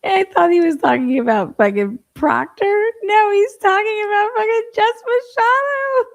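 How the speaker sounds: loud, high-pitched incredulous outburst; real high-pitched exclaiming; amused outburst. Real, not acted. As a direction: blurt it out loud and high, cracking up. Cracking up in amused disbelief.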